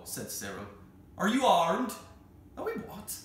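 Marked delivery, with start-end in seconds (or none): 1.2-1.9 loud, high call. Acted. Keeper calls through door. 2.6-3.1 startled, much higher question; surprised. Acted. Startled child reply.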